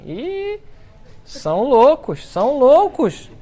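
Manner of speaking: high, loud, slow mock old-lady cry; hammed-up lady tutting at madmen; scandalised old lady. Passing lady sees the shouting priest, calling them mad in mock shock.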